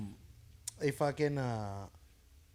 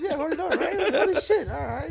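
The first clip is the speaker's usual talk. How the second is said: much higher goofy voice fishing for laughs; mock clueless comic; 11 semitones higher, 3 dB louder than usual. Meme comic asking a silent crowd for approval, high goofy voice. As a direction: play a comic sure his joke landed, pitched way up.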